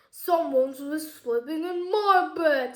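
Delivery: slow, loud papa bear voice; big drawn-out bear boom; booming Daddy Bear. A narrator's reading. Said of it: "in a big booming voice".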